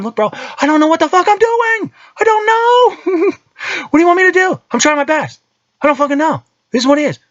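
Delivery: mock helpless parent throwing up his hands; exasperated dad to son. Dad telling his five-year-old he's clueless, high mock despair. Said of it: "like I tell my five-year-old".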